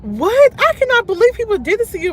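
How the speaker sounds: high, loud disbelieving outburst; real incredulous "what?!"; shocked disbelief. Real, not acted. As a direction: react in quick, high-pitched shock. Reacting to a viewer's story.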